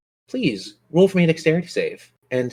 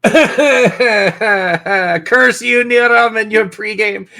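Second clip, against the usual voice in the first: high, louder hammed-up outrage at a player; mock curse; 11 semitones higher, 4 dB louder than usual. Game master mock-curses the player after rolling a one, hammed-up outrage. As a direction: shake a fist in jest, grinning.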